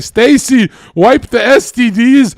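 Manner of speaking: loud, high mock command; calling out orders. Man phones Stacy to reform her, calling out orders. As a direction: call her up and order her about.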